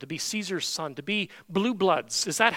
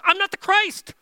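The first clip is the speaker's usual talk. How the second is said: much higher and louder, "I'm not the Christ." with full strength; emphatic declaration; 13 semitones higher, 8 dB louder than usual. Shown as a demonstration. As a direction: proclaim it boldly. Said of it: "Like this".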